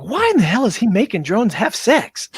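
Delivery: high-pitched mock disbelief; acted-out incredulous question; retold bewilderment. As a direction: replay your baffled reaction. Acts out own bewilderment at video.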